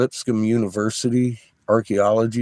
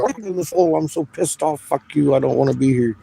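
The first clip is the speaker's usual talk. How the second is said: higher, retold yell, only a little louder; mild mock yell; 7 semitones higher, 3 dB louder than usual. Retelling yelling at the dig hole, mild mock yell. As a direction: replay your angry yelling at the hole. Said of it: "I was standing there yelling".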